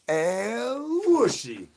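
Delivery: very slow and high, stretched out; drawn-out high call. Acted. Streamer calling out his catchphrase in a slow high drawl. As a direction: croon the name, high and long.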